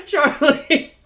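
cracking up mid-name; laughing helplessly. Real, not acted.

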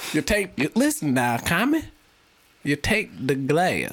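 drawn-out Southern instruction; hammed-up good-old-boy drawl; Southern American accent. Player lectures friend on glass, slow Southern accent. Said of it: "Southern American right there".

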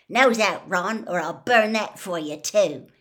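a loud brotherly threat; threatening. A narrator's reading. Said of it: "waving his wand threateningly".

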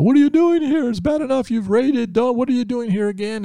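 panicked king, high and loud; freaked-out king. Acted. Preacher voicing the panicking king at Naaman: high frantic shout. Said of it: "the king totally freaks out".

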